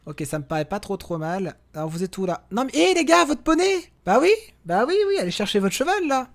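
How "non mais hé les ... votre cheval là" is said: high, loud call to the lagging companions; high startled yell at companions; exasperated call. Real, not acted. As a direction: shout up at them in disbelief. Yelling at AI companions in game.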